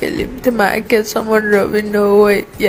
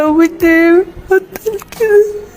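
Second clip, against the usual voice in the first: higher, louder, tearful pleading; tearful plea; 6 semitones higher, 4 dB louder than usual. Real, not acted. Daughter begging God to make father stop, tearful. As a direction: beg through tears. Pleading she doesn't want this.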